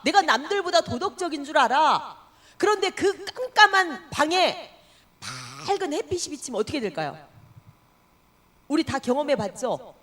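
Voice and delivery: ordinary talk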